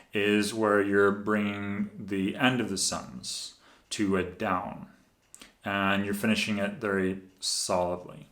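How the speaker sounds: slow, falling ends landing on "down" and "solidly"; downward inflection. Shown as a demonstration. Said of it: "That's kind of downward voice inflection".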